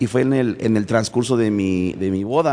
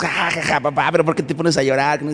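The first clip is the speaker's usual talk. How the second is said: put-on teasing kids' laugh; 6 semitones higher, faster than usual. Kids teasing crying father.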